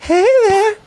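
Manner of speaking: put-on very high, slow, creepy old man; Herbert impression. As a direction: croon it like a creepy old neighbor. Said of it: "he's gonna be like Herbert".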